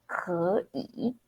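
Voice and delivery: 可以 as second then third tone; tone sandhi. Shown as a demonstration. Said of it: "second, third".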